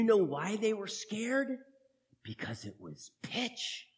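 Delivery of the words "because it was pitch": spooky hush. A narrator's reading. Spooky reveal to children.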